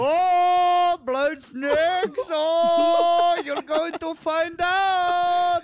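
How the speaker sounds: high, loud, slow Indian dad; excited Indian accent. Acted. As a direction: boom it out like a giddy Indian father. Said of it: "sounds like an excited Indian man".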